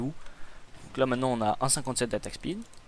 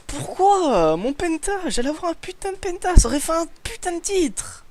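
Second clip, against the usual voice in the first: high, drawn-out frustrated cry; exasperated wail; 14 semitones higher, 4 dB louder than usual. Real, not acted. Ranting about lost penta.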